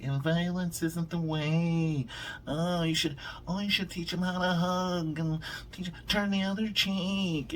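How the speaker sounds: mock the hand-wringing objectors in a high pitch; preachy critics. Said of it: "They're like".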